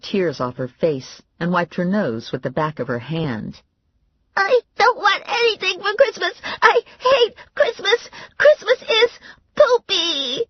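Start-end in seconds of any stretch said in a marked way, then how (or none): 4.4-8.0 very high, sobbing words; crying child. A narrator's reading. Mary Alice bawling. 8.4-10.4 very high, louder tearful pout; sulky child. A narrator's reading. Crying child.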